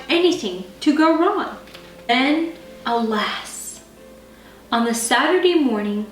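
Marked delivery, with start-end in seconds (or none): none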